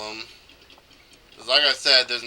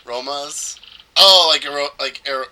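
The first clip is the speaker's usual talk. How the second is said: ordinary talk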